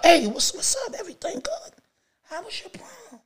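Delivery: very high, hushed retold question; timid asking. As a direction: ask her in a tiny, tentative voice. Retold careful question to girlfriend.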